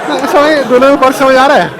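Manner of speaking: high, loud eager question; teacher asking the class, excited and loud; excited check-in. Real, not acted. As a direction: grab the class with a bright high call.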